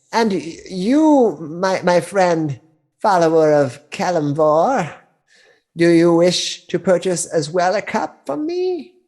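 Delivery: put-on high, slow sweet old crone; a kindly old hag selling her wares.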